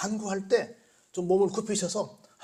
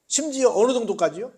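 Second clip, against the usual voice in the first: ordinary delivery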